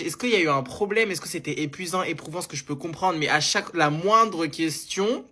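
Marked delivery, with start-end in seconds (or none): none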